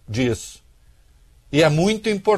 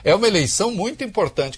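lightly voiced film dubber, higher pitch; a touch of dubber's lilt, pitched up; dubbing voice; 6 semitones higher than usual. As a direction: mimic a dubbed translation. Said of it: "eu fico imaginando uma voz de dublador".

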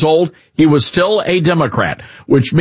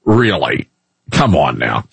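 exasperated disbelief; 5 semitones lower than usual. Retold or imitated. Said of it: "you're like".

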